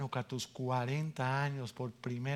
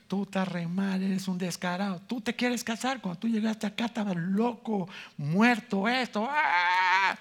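higher, louder scolding rant ending in a yell; pastor scolding; 6 semitones higher, 4 dB louder than usual. Retold or imitated. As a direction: tear into him like the pastor did. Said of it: "Ahí me cayó con todo".

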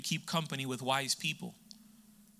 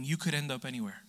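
ordinary delivery

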